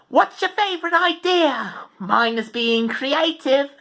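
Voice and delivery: high, upbeat character voice; upbeat Paige. Shown as a demonstration. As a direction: chirp it high and perky.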